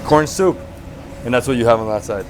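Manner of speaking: usual speaking voice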